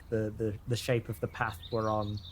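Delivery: usual speaking voice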